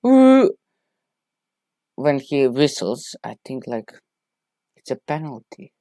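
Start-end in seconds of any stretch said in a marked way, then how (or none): 0.1-0.5 imitated ref whistle ooh. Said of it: "ref, like".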